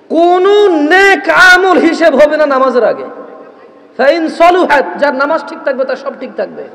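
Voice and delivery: forceful preacher driving home a warning; fiery preaching. Acted. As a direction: preach it hard to the crowd. Fiery sermon on prayer.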